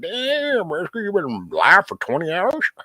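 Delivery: high, hammed-up disbelief; mock viewer shock. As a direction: play a startled viewer gasping at the stream time. Mimics a viewer reacting in chat.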